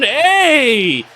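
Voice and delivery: high, loud, thrilled shout; excited cheer. Real, not acted. Reactor getting excited over a player, excited cheer. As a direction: burst out cheering at the play. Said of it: "you're getting me excited now".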